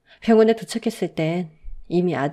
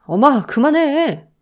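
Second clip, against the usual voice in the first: slow, raised plea; pleading stop; 7 semitones higher, 6 dB louder, slower than usual. A narrator's reading. Husband stopping his raging mother, pleading. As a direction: beg her to stop.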